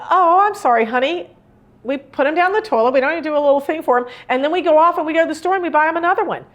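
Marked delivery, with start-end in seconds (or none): 0.1-3.1 sympathetic parent. Retold or imitated. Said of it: "And you're like".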